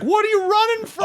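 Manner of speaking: high, bright interviewer voice. Retold or imitated. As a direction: ask it in a high squeaky voice. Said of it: "he's like".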